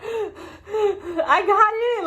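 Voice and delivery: high, drawn-out happy cry; overjoyed near-tears squeal on getting accepted; tearful joy. Retold or imitated. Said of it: "I'm like, gasps".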